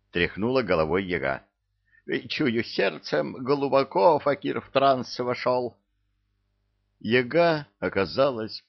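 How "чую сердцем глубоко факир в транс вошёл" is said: put-on old witch voice. A narrator's reading. Yaga's old-woman voice.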